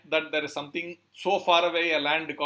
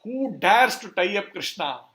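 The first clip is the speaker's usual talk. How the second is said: loud, slow, raised-pitch challenge; angry shout; 4 semitones higher, 7 dB louder than usual. Retold or imitated. Balram sees Krishna tied up, acted angry shout. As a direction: thunder it out as an outraged protector. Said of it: "He, he shouts".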